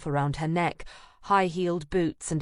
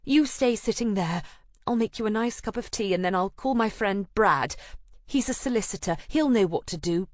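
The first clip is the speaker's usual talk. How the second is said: high, brisk friend voice; organising her friend firmly; take-charge friend; 4 semitones higher than usual. Acted. Anna taking charge.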